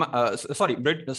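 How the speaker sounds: ordinary delivery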